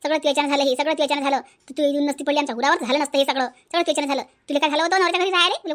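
low-pitched, grumbling blame; scolding mother. Acted. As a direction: scold your daughter in a low voice. (खालच्या सुरात रागावणारी आई)

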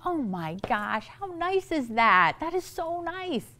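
a quiet, delighted aside to a friend; soft touched gush. Retold or imitated. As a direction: melt at the kindness, hushed. Touched by a kind driver.

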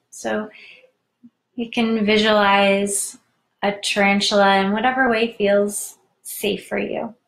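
measured meditation voice with long pauses; guided meditation. Real, not acted. Host guides listeners into tarantula meditation, unhurried guided-meditation voice. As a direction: guide them inward, leaving room to breathe.

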